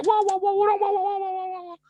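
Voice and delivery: high-pitched mock chatter of a complaining wife; babbling wife. Preacher mimics the first wife complaining to him, in high-pitched mock chatter. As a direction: rattle off wah wah like a gossiping wife. Imitating second wife's chatter.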